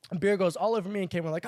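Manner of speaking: ordinary delivery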